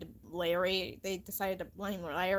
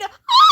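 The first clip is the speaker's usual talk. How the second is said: high, loud shipwreck scream; 19 dB louder than usual. Acted. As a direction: shriek as the boat hits the rock. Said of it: "and we all screamed".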